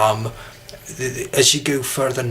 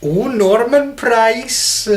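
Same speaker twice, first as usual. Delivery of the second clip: high, drawn-out Welsh lilt; slow sing-song Welsh exclamation; Welsh accent; 10 semitones higher, 4 dB louder, much slower than usual. Shown as a demonstration. Man demonstrating Welsh with a Fireman Sam line, Welsh accent. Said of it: "whenever I do a Welsh accent".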